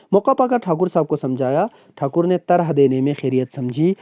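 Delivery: ordinary talk, nothing marked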